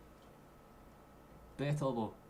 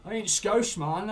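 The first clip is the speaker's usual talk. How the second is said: higher and louder, indignant; loud mock-offended protest; 8 semitones higher, 9 dB louder, slower than usual. Real, not acted. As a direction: shout the denial back at the chat. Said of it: "calm down, lad".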